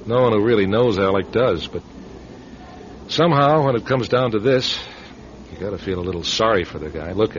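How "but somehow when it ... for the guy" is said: ordinary talk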